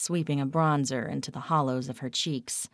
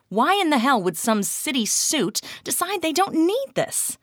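loud, much higher outrage; outraged; 11 semitones higher, 5 dB louder than usual. A narrator's reading. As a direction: demand it furiously. Kat outraged.

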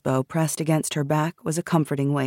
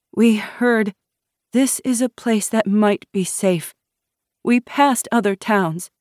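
higher-pitched, lightly voiced young woman; anxious refugee woman; 7 semitones higher, 3 dB louder than usual. A narrator's reading. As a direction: explain her plight to armed guards. Refugee woman pleads her case.

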